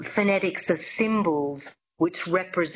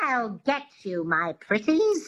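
high, twangy witch voice; drawn-out twang of a storybook witch; Wicked Witch; 3 semitones higher than usual. Shown as a demonstration. Coach demonstrates twang for pupil, witch voice. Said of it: "Think of the Wicked Witch from The Wizard of Oz".

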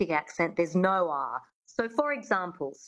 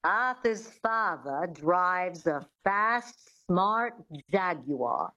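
slow, careful transatlantic sentence with no R's and a long open ah; transatlantic accent; much slower than usual. Shown as a demonstration. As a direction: say "Arthur's father drives a fast, smart Jaguar" slowly, dropping every R. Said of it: "use a sentence to get into accents".

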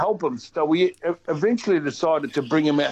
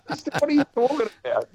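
pitched far higher, rushed and incredulous; a fast, high mock of someone baffled by the question; incredulous retort; 11 semitones higher, much faster than usual. Telling the host how someone scoffed, in a high rushed voice. Exasperated reply quoted with laugh.

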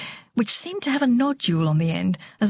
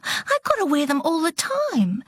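put-on higher girl's voice, refusing then trailing into a weak excuse; lame excuse; 9 semitones higher than usual. A narrator's reading. Delore refuses Harry, then lamely excuses her glasses. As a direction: start firm, then go limp and feeble. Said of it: "she added lamely".

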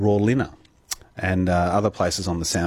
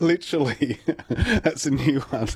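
words laughed out, higher; laughing; 6 semitones higher than usual. Real, not acted. Host laughing at the caller's pyjama story. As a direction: chuckle the words out slowly.

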